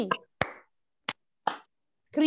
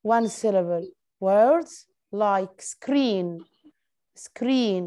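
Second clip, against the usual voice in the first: slow teaching read; 2 semitones lower, much slower than usual. Shown as a demonstration. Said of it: "teacher reads example slowly".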